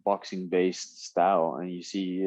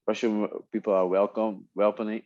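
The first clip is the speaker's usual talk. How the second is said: normal talking voice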